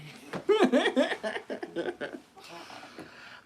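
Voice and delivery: high-pitched giggling fit; a long, high laugh trailing out. Real, not acted. Streamer laughing at their own hat remark, high giggle.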